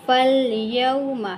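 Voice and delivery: फल यौम read slowly, short fa joined straight into lam; recited Quranic reading. Shown as a demonstration. Teacher models Quran word for learners, slow recitation. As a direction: say फल यौम slowly, skipping the silent alif. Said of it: "तो इसको हम पढ़ेंगे".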